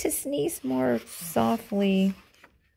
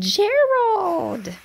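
high playful call; 8 semitones higher, 3 dB louder than usual. Acted. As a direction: call his name brightly. Piggy calls Gerald.

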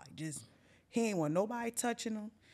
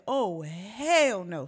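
very slow, drawn-out "hell"; emphatic refusal; 5 dB louder, much slower than usual. Retold or imitated. Storyteller when friend passes out again, emphatic refusal. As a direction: stretch the refusal, leaning loud on "hell".

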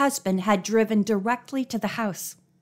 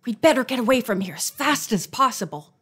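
ordinary delivery

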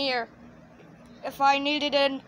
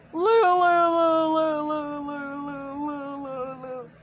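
siren-like wailing; slower than usual. Acted. Jeffy after the cue to go, long fading wail. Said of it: "Jeffy is gonna be like".

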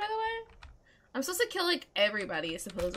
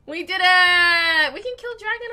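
victory shout; 4 semitones higher, 9 dB louder than usual. Real, not acted. Player celebrating the dragon kill, triumphant shout. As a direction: yell it like you just won.

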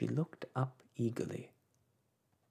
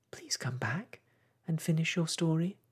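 lightly raised call after someone leaving; 8 semitones higher, 6 dB louder than usual. A narrator's reading. Alice calling after the mouse to come back, mildly raised. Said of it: "Alice called after it".

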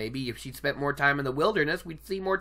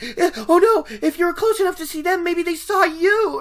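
imitating a panicked girl, pitched much higher and louder; hammed-up girlish panic, high and loud; panicky; 12 semitones higher, 8 dB louder than usual. Egwene panicking to Lan, mock frightened high voice. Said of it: "Egwene shows her fear in her youth by panicking".

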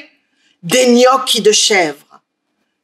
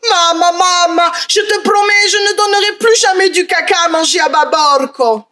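put-on pleading child shout; 7 semitones higher than usual. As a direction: cry out a frantic promise. Boy begs his mother.

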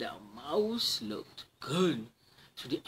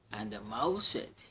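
ordinary delivery, nothing marked

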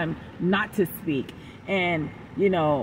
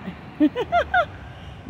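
high laugh. Real, not acted. Laughs at her own joke.

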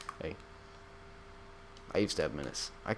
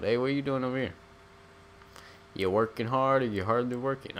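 higher, sing-song jokey greeting; goofy greeter voice; 5 semitones higher than usual. Pretend. Gamer greeting NPC workers in a higher sing-song voice. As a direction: schmooze the workers like a cheesy boss. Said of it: "That's, like, from Shrek".

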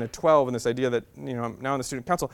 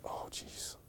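a breathless whisper of dismay; 19 dB quieter than usual. Retold or imitated. Boy staring at the results, whispered dismay. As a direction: whisper it under your breath. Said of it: "I'm like".

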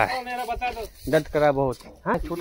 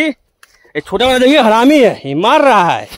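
higher, raised exclamation; startled outcry; 5 semitones higher, 5 dB louder, slower than usual. Real, not acted. Vlogger dodges the small bull butting him, startled.

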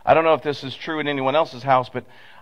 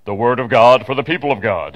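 mock echo voice; 4 semitones lower, 4 dB louder than usual. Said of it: "it almost sounds like".

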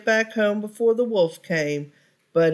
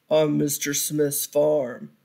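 a lightly lowered, sly wolf voice; 4 semitones lower than usual. Acted. Wolf luring the pig to the turnip field, sly and low. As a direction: coax him like a crafty wolf.

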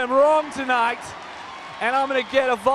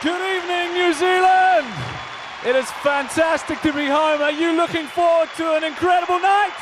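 shouted, higher greeting to the crowd; crowd hype; 6 semitones higher than usual. Real, not acted. Singer shouting a welcome to the stadium crowd. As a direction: rev up the whole stadium.

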